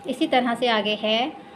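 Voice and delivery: normal talking voice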